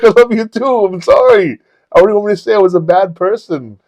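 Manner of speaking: loud, high-pitched pleading, retold; emphatic apology. Man apologising to a hurt friend, pleading loudly. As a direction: blurt out the apology over a laugh.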